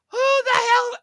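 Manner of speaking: sudden high-pitched outburst; indignant squeak. Real, not acted. Exclaiming at the other man in high-pitched disbelief.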